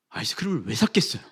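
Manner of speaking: normal talking voice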